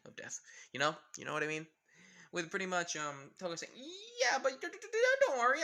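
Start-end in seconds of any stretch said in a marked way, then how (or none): 4.2-5.6 flustered stammer. Acted. Toga stammers, caught off guard.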